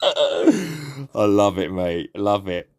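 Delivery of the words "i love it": very slow laughing words; drawn out through laughter. Real, not acted. Laughing at prank.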